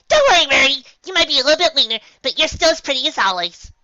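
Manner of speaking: high, loud bubbly voice; Pinkie cheerful. Acted. Pinkie reassuring Galahad, high and loud. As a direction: bounce it out brightly.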